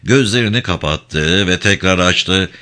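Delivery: ordinary delivery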